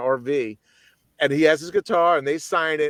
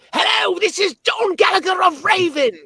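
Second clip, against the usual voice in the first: high, loud screamed greeting, held long; wicked high scream; 6 dB louder than usual. Retold or imitated. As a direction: scream it like a metal singer on an answering machine. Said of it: "he does this wicked high, crazy scream".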